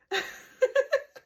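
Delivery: high real laugh; loud, high giggle at the insult; high burst of laughter. Real, not acted. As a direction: laugh out loud at your own joke.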